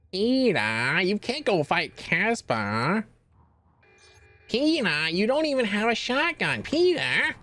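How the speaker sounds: high nagging voice calling Peter; Lois impression. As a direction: play the exasperated wife scolding him. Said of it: "Hi, Lois".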